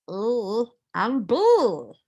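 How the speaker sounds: bouncy little cartoon voice; cartoon character voice. Shown as a demonstration. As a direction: squeak it like a tiny cartoon ghost. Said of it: "A little cartoon character voice".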